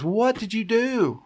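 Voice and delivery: high, slow, accusing mom voice; suspicious mom. Retold or imitated. Mom hears the baby scream, asks her son, suspicious. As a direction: drag it out like a mom who already knows.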